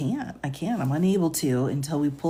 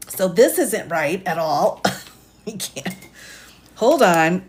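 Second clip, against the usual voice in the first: normal talking voice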